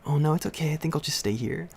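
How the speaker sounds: high, sweet, polite brush-off; overly polite kid. Retold or imitated. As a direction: act the well-mannered child declining nicely. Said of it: "I'm all polite about it".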